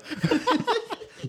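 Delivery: high squealing laugh; high burst of laughter. Real, not acted. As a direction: crack up high. Big laugh at story.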